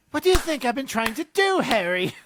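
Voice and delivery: high, loud exasperated Hermione. Retold or imitated. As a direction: snap back at Harry like an exasperated Hermione. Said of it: "And she says".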